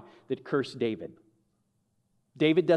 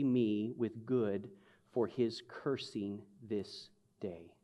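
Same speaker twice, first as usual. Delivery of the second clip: scripture reading; 4 semitones lower, 7 dB quieter than usual. Acted. Reads scripture quietly.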